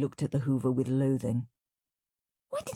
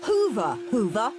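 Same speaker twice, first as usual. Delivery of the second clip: raised, commanding call; ordering; 5 semitones higher, 3 dB louder than usual. A narrator's reading. Henry commanding the hoover to work, ordering. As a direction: order it about like a servant. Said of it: "ordered Henry".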